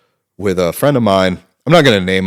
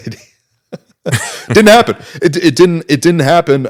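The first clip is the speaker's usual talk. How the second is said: talking through real laughter, pitched high; high, louder, laughing delivery; 9 semitones higher, 6 dB louder than usual. Real, not acted. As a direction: crack up while getting the words out. Cracking up at own story.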